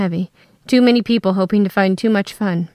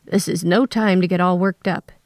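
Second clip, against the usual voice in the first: usual speaking voice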